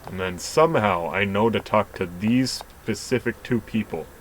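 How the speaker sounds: ordinary delivery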